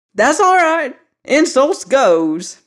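put-on high, loud, slow old-timer retort; a cranky old man, pitched high and dragging; indignant old man. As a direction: drag out the words like a wounded old codger.

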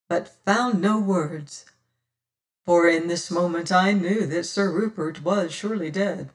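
ordinary delivery

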